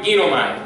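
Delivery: ginomai said clearly, falling; model Greek word. Shown as a demonstration. Teacher models ginomai for student to repeat. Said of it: "Repíteme, por favor".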